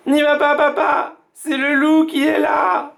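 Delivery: voix d'enfant très aiguë, suppliante; enfants apeurés. A narrator's reading. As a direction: supplier papa de ne pas ouvrir. Children cling, begging. (enfants qui supplient, voix aiguë)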